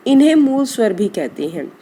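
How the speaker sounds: normal talking voice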